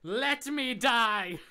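high, loud, drawn-out cry; melodramatic shout. Acted. Jekyll begging Hyde after drinking poison, melodramatic shout. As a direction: wail it slowly at full voice.

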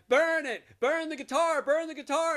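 hammed-up frenzied cheering; wild yelling. [name] cheering wildly at [name].

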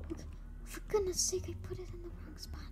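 quick annoyed whisper; whispered frustration. Real, not acted. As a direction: whisper it fast to yourself. Muttered frustration.

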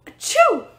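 big explosive sneeze; loud sneeze. Acted. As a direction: sneeze hard, mid-goodbye. Sick Percy sneezes mid-sentence.

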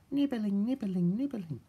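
high, small repeated word; tiny nibbling voice. A narrator's reading. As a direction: mimic little teeth at work. Narrator mimics tiny busy nibbling.

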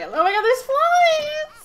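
high, slow shocked shriek. Real, not acted. Vlogger spotting flies at the bird's grave, high shocked shriek.

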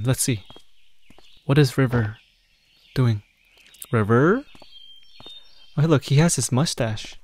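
normal talking voice

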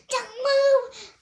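high, loud command; commanding shout. A narrator's reading. Terrell pointing the wand at the magician, shouting. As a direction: stop him cold.

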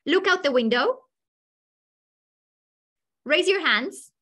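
teacher directing the class in positive terms; teacher giving commands. Shown as a demonstration. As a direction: call out simple instructions to the class. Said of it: "Do as I say".

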